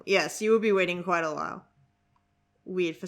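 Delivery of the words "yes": normal talking voice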